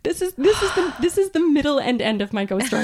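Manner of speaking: high, loud scared laugh-talk; nervous laughing. Real, not acted. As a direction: laugh through real fright. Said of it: "I'm so scared right now".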